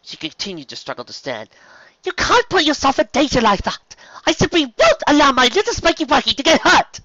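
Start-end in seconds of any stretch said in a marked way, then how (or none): 2.0-7.0 high, loud protective outburst; frantic scolding. A narrator's reading. Rarity protecting her little Spike, frantic scolding. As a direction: forbid it at high pitch and full voice.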